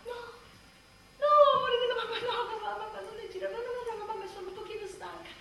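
sudden sweet reassurance. Acted. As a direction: soothe the baby sweetly. Switching to sweet voice for baby.

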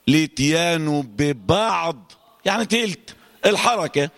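usual speaking voice

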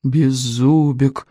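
slow, drawn-out reproach on the name; reproachful. A narrator's reading. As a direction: chide him by stretching out his name. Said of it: "укоризненно произнёс один клык".

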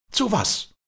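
high-pitched, baffled query; incredulous question. Acted. Walli asks Josef why release the bird, high and incredulous. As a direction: demand a reason in disbelief.

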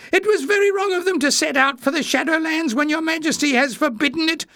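very high, squeaky whine; high-pitched tale-telling; whining. A narrator's reading. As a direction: whine to the king, far up in pitch. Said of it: "whined Jinx to Leaf".

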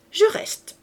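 high, rising little declaration; triumphant pout. A narrator's reading. Cosette telling her husband she stays, triumphant pout. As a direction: declare victory with a childlike pout. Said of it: "avec une petite moue triomphante".

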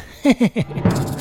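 breathy villain chuckle; smug triumphant cackle; gloating laugh. Acted. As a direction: laugh at the rival's ruin. Gloating after the call.

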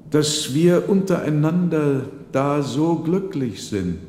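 put-on an old father praising his family's happiness; old father's voice. Old man speaks at family table.

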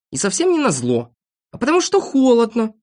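high-pitched little character protesting; indignant denial. A narrator's reading. Shivering friend denies doing it for spite, protesting. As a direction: answer back, rising high. Accused character answers back.

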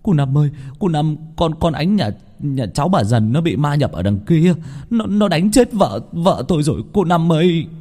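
high-pitched, panicked tearful plea; distraught man begging for help; tearful whimpering. A narrator's reading. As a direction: stammer it out on the edge of sobbing. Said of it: "thì người đàn ông mếu máo".

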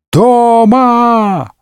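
high, drawn-out acted howl; loud wail. A narrator's reading. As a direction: bawl the word in a long high wail. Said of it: "но тут же снова заголосил". (истошный театральный вопль)

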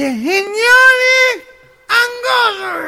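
discurso nasal, muy agudo y alargado; presidente gangoso. Acted. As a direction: proclama desde el atril como gangoso imitado. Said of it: "Se para en el atril y dice". (orador gangoso llamando a todos)

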